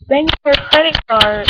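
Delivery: loud, commanding call; shouting. Real, not acted. Yelling instruction.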